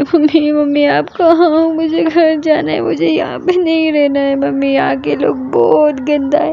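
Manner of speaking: put-on high, tearful little girl pleading; crying child. A narrator's reading. Little girl crying for her mummy, tearful. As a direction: sob for mummy like a lost child. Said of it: "बोल रही होती है और रो रही होती है".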